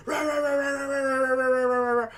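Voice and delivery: high, sustained rapid-fire dog noise; imitated frantic barking. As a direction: bark nonstop like an over-aroused dog. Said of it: "your dog's like".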